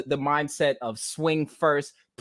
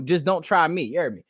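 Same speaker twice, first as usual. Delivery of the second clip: ordinary talk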